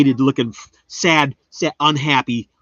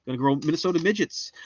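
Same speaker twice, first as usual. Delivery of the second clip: ordinary delivery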